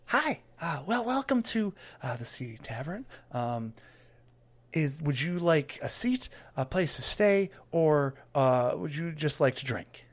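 nervous little greeter. Acted. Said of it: "just kinda looks up and goes".

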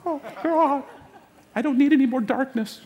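mock high-pitched wail of dread; shriveling dread. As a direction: shrink away in exaggerated dismay. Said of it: "I could see you just shriveling".

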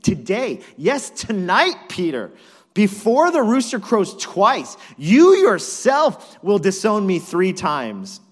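loud, high, punched phrases; emphatic, high-volume warning; forceful prediction. Acted. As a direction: hammer each phrase of the prophecy home. Jesus answers Peter's boast.

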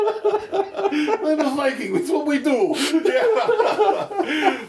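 high, slow, laughing Viking voice. Retold or imitated. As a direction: ham up a goofy Viking. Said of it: "I like boats".

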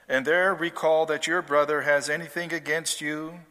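normal talking voice

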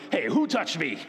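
loud, offhand "Who touched me?"; a careless, demanding "Who touched me?"; brusque demand. Shown as a demonstration. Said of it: "I don't think he says it like that".

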